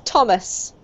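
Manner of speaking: Thomas with a T, not TH; plain T sound. Shown as a demonstration. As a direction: say Thomas starting with a hard T. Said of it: "just a T sound".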